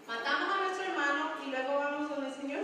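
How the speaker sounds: ordinary delivery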